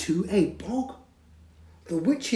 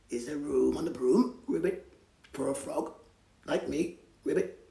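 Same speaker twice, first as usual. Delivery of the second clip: frog voice; 3 semitones lower, faster than usual. Acted. Frog asks for room.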